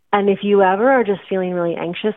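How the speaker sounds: usual speaking voice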